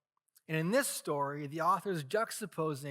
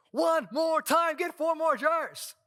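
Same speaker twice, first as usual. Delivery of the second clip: much higher and louder, slow emphatic order; commanding shout; 12 semitones higher, 7 dB louder, slower than usual. Retold or imitated. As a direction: demand it boldly with every word stretched. Elijah ordering more water.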